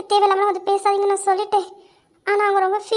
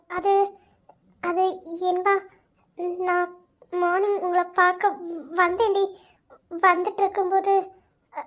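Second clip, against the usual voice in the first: quiet, halting, troubled account; hesitant confession; 5 dB quieter than usual. Acted. Confused friend confessing whom she met, hesitant. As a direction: feel your way through with long pauses.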